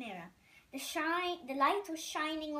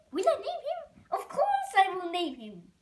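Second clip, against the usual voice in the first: much higher, louder indignant French outburst; rising French-accented huff, louder; 7 semitones higher, 8 dB louder than usual. Acted. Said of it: "A, a French accent".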